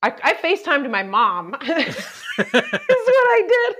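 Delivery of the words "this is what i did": high, slow, laughing through words. Real, not acted. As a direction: laugh it out, amused at yourself. Laughing about FaceTiming mom.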